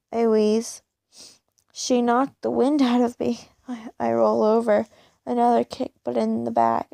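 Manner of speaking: plain reading voice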